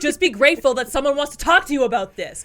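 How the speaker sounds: loud, high insistent mother; pushy mom. Retold or imitated. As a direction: demand gratitude, rising loud. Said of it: "And she was like".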